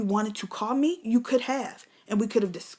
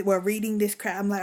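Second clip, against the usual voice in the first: usual speaking voice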